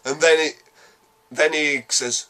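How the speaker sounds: ordinary talk, nothing marked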